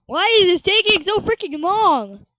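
fed up and loud; impatient complaint. Real, not acted. Gamer waiting on the loading screen, annoyed.

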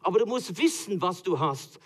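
ordinary delivery

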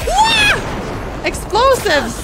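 high, loud stretched cry; excited. Real, not acted. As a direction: drag out the excited shout. Excited at explosion.

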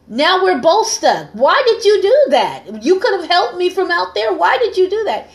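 high-pitched acted dismay, rising in disbelief; exasperated protest. Trapped man protests to his would-be rescuer.

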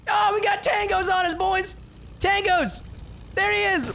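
high urgent shout of tangos; shouted callout. Real, not acted. Streamer yelling to teammates about enemies, high shout. Enemies spotted, calling out to team.

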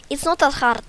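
loud, high put-on Russian accent. Shown as a demonstration. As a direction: insist in a mock Russian accent. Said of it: "gonna just talk in Russian accent".

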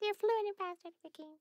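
ordinary delivery

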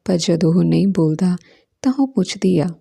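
ordinary delivery, nothing marked

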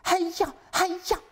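high, loud chant of heia heia; rooting for your team; wife chanting at the television; cheering. Acted. Wife cheering at ski race.

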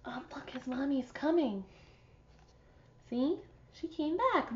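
ordinary delivery

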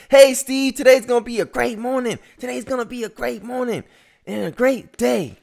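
bright, very high and loud cheer; cheerful wake-up call. Retold or imitated. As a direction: sing out the greeting like a morning hype man.

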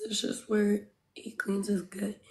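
whispered aside; whispering. Real, not acted. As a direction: say it under your breath to chat. Aside explaining to chat.

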